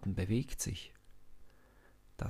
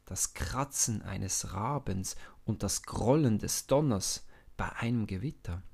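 usual speaking voice

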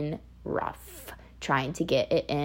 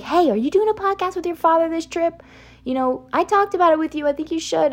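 higher-pitched imitation of mom; retold mom, pitched up and chatty; mom's message; 10 semitones higher, faster than usual. Mom asking about a podcast with dad, imitated in a higher voice. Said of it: "She was like".

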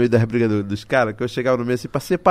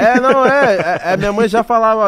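loud high excited burst; 9 semitones higher, 9 dB louder than usual. Real, not acted.

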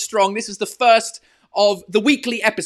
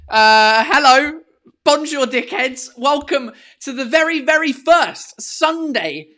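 higher, drawn-out announcer welcome; show host opening; 6 semitones higher, 5 dB louder, slower than usual. Acted. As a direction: greet the crowd like a showman.

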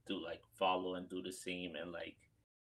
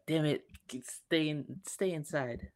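put-on talking to his escaping accent; 7 semitones higher than usual.

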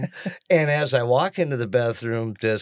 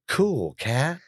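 lightly voiced beatnik cool; hip assistant. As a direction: put a hint of hipster inflection on it. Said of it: "running a new inflection algorithm".

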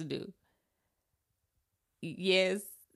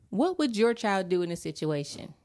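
ordinary delivery

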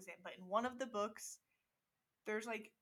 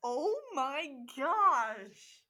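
drawn-out shocked gasp; 3 semitones higher, 8 dB louder, much slower than usual. Real, not acted. Reacts to shocking story.

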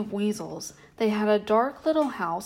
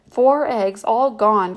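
louder, a rabbit crying out in dismay; 7 dB louder, slower than usual. A narrator's reading. Gray Rabbit finding the basket empty, loud dismayed cry. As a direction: let the shock burst out loud. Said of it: "cried Gray Rabbit".

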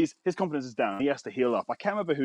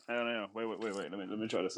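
low murmur, much softer; hushed; 4 semitones lower, 12 dB quieter, faster than usual. Real, not acted. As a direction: drop the voice right down. Hushing the room to try something.

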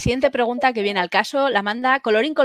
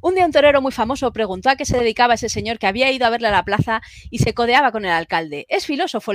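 súper rápido. Shown as a demonstration. Host speeds through a listener's message to save time, super fast. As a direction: read it quickly, like an ad. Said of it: "te la leo súper rápido, como si fuera un anuncio".

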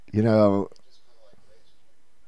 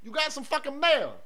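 much higher, softer cartoon voice; squeaky mock voice; 6 dB quieter than usual. Parody in squeaky put-on voice.

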